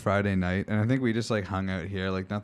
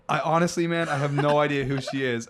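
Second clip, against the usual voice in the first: higher, slightly louder mock earnest reply; retold own reply; 7 semitones higher, 4 dB louder than usual. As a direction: swear you don't know her. Her own retold answer.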